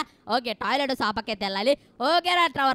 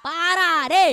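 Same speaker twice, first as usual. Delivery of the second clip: loud, raised-pitch call; urgent shout; 5 semitones higher, 7 dB louder, much slower than usual. Real, not acted. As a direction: yell at him to get moving. Calling out to a game character. (గట్టిగా అరిచి పిలవడం)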